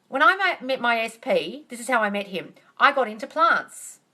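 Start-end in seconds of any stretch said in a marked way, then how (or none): none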